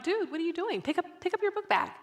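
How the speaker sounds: mock-exasperated, high "what are you doing"; puzzled prodding. Mom questioning dragging child.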